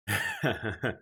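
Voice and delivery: short burst of chuckles; laugh. Real, not acted. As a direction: let out a quick real laugh. Laughs at own enunciation remark.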